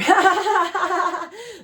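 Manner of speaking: loud, high giggling laugh; real high laugh; high burst of laughter. Real, not acted. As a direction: crack up at the card. Laughs at the reading.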